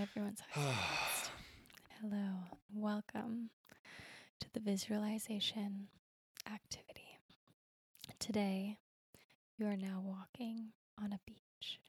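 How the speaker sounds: soft, slow, whispery meditation read; guided meditation voice. Pretend. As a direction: lull them onto a calm beach in a hushed voice. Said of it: "you could lead me through a very relaxing meditation visualization".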